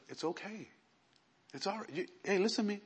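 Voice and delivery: very soft reassurance; retold compassion. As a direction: hush someone kindly and get their attention. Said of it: "gave me so much compassion for him".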